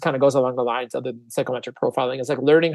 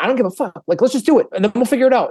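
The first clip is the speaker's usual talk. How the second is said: impatient go-getter; 7 semitones higher, faster than usual. Retold or imitated. Impatient retort to technical partners.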